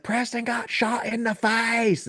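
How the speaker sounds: high, stretched-out Southern holler; imitated Louisiana scream. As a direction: mimic the thick-accented screamer, drawing words out. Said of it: "just the way he's screaming it".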